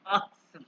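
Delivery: आसिम with a "ts" inside, "AT-sem"; German pronunciation. Retold or imitated. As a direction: say आसिम as Germans would, "atsem". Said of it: "मुझे German वाले कहते थे".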